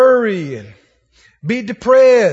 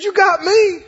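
playful husband; 8 semitones higher, 4 dB louder, slower than usual. Retold or imitated. Elkanah's playful boast.

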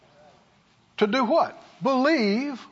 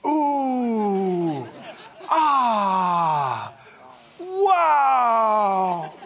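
breathless ooh, ah, wow, half crying out; overwhelmed with wonder, voice catching; awestruck gasps; much slower than usual. Acted. Believers marvelling at God's goodness, crying out in awe. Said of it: "We'll all go".

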